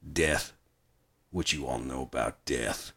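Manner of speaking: low, quiet, lightly voiced Barnes; Barnes quote. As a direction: drop it low like a tired threat. Retelling Barnes's final line.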